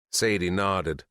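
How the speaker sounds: usual speaking voice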